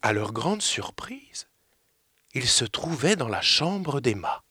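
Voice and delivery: slow hushed whisper. A narrator's reading. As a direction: lean in and whisper the reveal. Narratrice baisse la voix. (chuchoté lentement)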